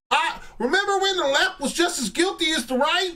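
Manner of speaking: hammed-up whiny caller impression; mocking caller voice. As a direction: sneer it like a smug caller. Caller's smug whining quote.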